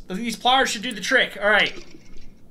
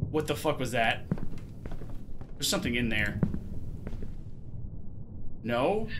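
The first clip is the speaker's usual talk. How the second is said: scared hushed; 9 semitones lower, 9 dB quieter than usual. Real, not acted.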